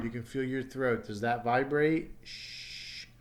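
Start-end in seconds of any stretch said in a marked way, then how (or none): 2.2-3.1 "Sh" as a long soft hiss, no voice; unvoiced SH sound. Shown as a demonstration. Teacher tests for throat vibration, voiceless SH demonstration. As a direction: hold "Sh" as pure breath. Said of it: "Ends in a sh sound".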